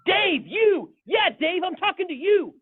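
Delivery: high, loud mock scolding; single Dave out in a fed-up shout; hammed-up high rant at one man; exasperated pastor yelling. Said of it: "he's like, he's like".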